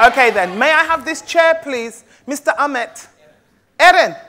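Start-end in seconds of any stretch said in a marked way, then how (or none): none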